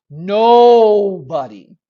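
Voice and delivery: "nobody" stretched long and loud; loud, high, drawn-out "nobody"; exaggerated NOBODY. Shown as a demonstration. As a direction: boom out "nobody", dragging the first syllable. Mocking sweeping words children use.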